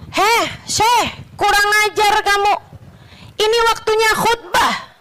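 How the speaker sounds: high-pitched mock indignant outburst; retold scolding. Congregant rebukes the sheikh during a sermon, acted indignant scolding. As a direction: act out a sharp, high-pitched telling-off.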